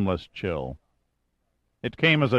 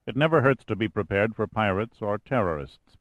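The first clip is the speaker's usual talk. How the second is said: ordinary delivery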